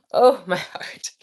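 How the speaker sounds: gasping, breathy shock; clutching her chest in panic; dramatic heart-stopping fright; mock heart attack. Real, not acted. Mock shock after slip.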